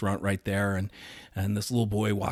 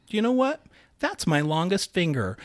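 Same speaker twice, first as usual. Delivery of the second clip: high, lightly voiced little boy; 8 semitones higher than usual. Imitating Rory showing off his finger, higher. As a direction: pipe it up like a proud six-year-old. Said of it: "he goes".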